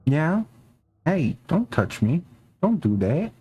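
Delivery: high, playful mock scolding; pet talk to the cat. Real, not acted. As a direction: tell the cat off sweetly.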